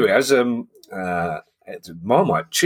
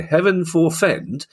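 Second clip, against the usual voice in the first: lightly hammed-up quaint phrase, higher and slow; mock Henry Cheese; 9 semitones higher, much slower than usual. Host mocking a Brighton fan's quaint phrasing, mock prim. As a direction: put on a prim old-fashioned fan voice, drawn out. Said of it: "a very Henry Cheese thing to say".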